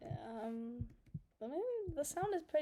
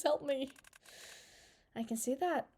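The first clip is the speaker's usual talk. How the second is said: ordinary talk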